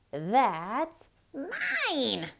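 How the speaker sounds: high squirrel squeak. Acted. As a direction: squeak it out possessively. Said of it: "squeaked the squirrel".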